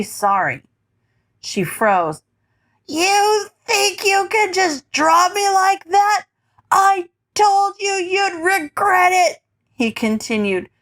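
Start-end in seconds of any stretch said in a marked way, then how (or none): 2.9-9.4 put-on high, slow drunken taunt; slurred taunting. A narrator's reading. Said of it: "his slurred voice taunting in tone".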